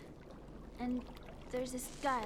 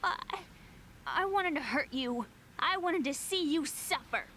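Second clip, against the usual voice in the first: angry outburst; 8 semitones higher than usual. Acted. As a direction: lash out at the brother who left. Sister accuses brother angrily.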